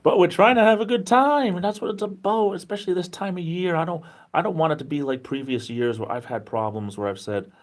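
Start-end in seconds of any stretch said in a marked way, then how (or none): 0.0-5.0 much higher, starting loud, easing off; pitched way up after laughing. Real, not acted. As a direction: come out of a laugh high and loud, then settle. Carries on straight from laughing.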